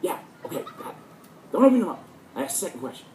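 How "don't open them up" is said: high and loud, firm order; sharp command. Retold or imitated. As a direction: order the student to keep eyes shut. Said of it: "He goes".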